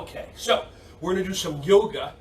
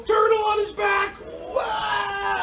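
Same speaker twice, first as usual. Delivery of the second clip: excited shout; much slower than usual. Real, not acted. Teacher cheering kids who rolled backwards, excited high shout. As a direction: cheer as they topple over. Kids tumbling during rolls.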